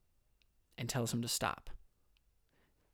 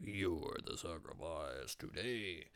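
low, soft, slow, dark line; ominous hypothetical; 8 semitones lower, 9 dB quieter, slower than usual. Acted. Abraham's unspoken answer to his son, low, slow and ominous. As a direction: drop the voice to a grim, slow reveal.

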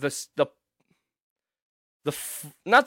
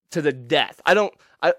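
normal talking voice

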